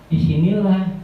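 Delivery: normal talking voice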